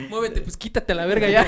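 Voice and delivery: laughing. Real, not acted. Laughing, pushing friend off.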